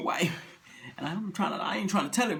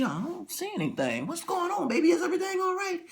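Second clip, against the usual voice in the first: high, soft wife voice; soft and high, gently puzzled; concerned wife; 9 semitones higher, 3 dB quieter than usual. Acted. Wife looks out the window, soft and high.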